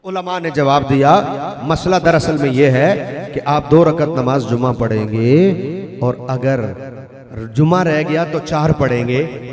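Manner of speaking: loud, low-pitched sermon delivery; ख़िताबत. Real, not acted. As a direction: lay out the ruling in a big low voice. Relays scholars' answer forcefully. (भारी ज़ोर के साथ नीची, बुलंद आवाज़)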